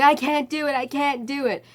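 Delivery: high whine, fading off; the screamer refusing the wheelchair; whining patient. Retold or imitated. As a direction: start loud then give up. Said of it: "And he's like".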